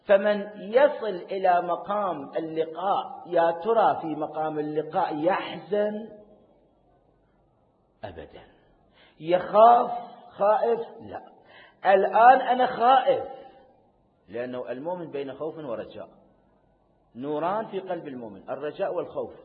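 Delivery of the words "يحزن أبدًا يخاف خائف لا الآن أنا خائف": loud, high rhetorical cry; impassioned preaching. Acted. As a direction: hurl the questions at the congregation. Preacher asks and answers.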